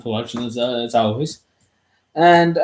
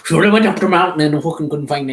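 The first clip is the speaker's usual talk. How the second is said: put-on Leitrim accent; 4 semitones higher, 4 dB louder than usual. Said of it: "West Leitrim Sligo kind of accent".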